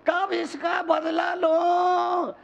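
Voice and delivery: high, drawn-out imitation of a grudge-holder; mock vengeful cry. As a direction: wail the question high and stretched, mocking. Grudge repeated, acted out. (बदले की तड़प की नकल, ऊँचे सुर में खींचकर)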